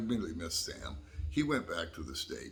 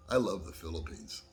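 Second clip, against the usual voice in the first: laughing. Real, not acted. Laughing at own realization.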